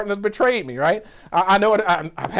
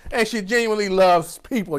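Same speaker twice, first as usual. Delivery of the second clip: normal talking voice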